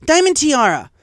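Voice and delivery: loud high teacher shout; sharp yell. Acted. Teacher shouts at bully, loud and sharp. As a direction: snap the name across the room.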